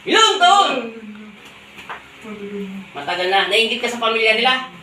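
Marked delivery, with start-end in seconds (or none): 0.1-0.7 high, loud demanding repeat; shouted question. Real, not acted. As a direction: yell the question again. Healer repeats question louder. (sigaw na tanong)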